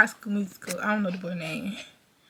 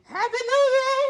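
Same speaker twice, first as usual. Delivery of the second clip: much higher, squealing happy new year; excited cheer; 10 semitones higher than usual. Real, not acted. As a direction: burst into mom's room cheering. Greeting mom at midnight.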